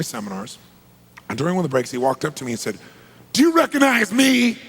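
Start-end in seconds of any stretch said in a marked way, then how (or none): 3.3-4.6 high, loud, intense demand. Retold or imitated. Stranger at a seminar break confronting the speaker, intense and loud. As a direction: confront him with a loud, pointed question. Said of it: "He was very intense".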